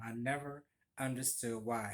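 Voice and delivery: normal talking voice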